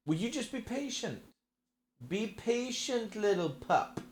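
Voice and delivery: soft high mock-scolding pet talk. Real, not acted. Cooing at the pet.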